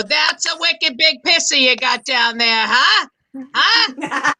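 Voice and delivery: lightly voiced Boston bloke, pleased with himself; leering Boston brag, rising huhs; Boston accent with mock swagger. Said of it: "it affected my sex life".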